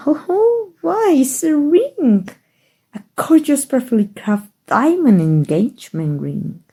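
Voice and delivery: mock surprise. Teasing him with hammed-up surprise over the ring. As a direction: pretend to discover the ring, hammed-up.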